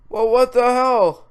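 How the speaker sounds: loud, high, drawn-out exclamation; exasperated outburst. Real, not acted. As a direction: burst out in exasperation. Exasperated at conflicting claims.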